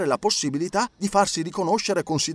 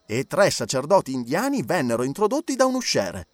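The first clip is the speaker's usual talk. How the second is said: ordinary delivery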